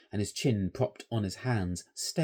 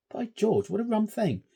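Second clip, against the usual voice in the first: ordinary delivery, nothing marked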